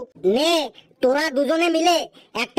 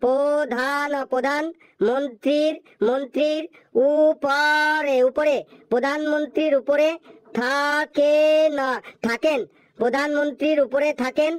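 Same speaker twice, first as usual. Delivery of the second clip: slow, stumbling syllable by syllable reading; halting reading; much slower than usual. Acted. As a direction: spell it out haltingly like a struggling pupil. Halting child reading aloud. (থেমে থেমে হোঁচট খেয়ে পড়া)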